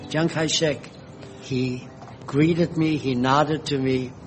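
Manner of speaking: ordinary delivery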